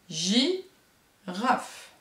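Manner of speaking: "Girafe" in two separate syllables, gi, raf; teacher's slow syllable-by-syllable "Girafe"; split into syllables. Shown as a demonstration. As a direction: break "Girafe" after "gi" with a clear pause. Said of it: "Elle a bien deux syllabes".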